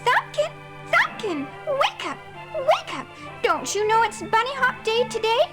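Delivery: very high, bright wake-up call; excited calling. Acted. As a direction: call him awake, bubbling over. Velvet Nose calling him awake.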